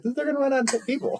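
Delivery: mock alarm. Mock alarm at sloppy plot.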